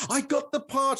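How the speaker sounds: high, bright squeal of joy over the part; mock thrilled Joan; imitating excitement. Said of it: "She gets all excited".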